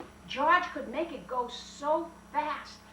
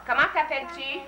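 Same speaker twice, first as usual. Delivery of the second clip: louder prompt; 2 semitones higher, 5 dB louder, faster than usual. Shown as a demonstration. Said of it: "teacher prompting child".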